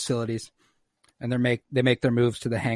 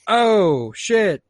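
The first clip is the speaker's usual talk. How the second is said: higher, louder startled big-guy oops; hammed-up startled oops; Wrecker caught out; 7 semitones higher, 5 dB louder than usual. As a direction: blurt it like a clumsy giant caught. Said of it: "Wrecker's like".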